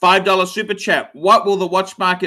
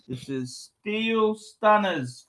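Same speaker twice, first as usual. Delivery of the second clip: soft, slow, reverent murmur; a collector whispering over his treasure; hushed reverence; 8 dB quieter, slower than usual. Acted. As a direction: savour the name under your breath. Repeats the name hushed and slow.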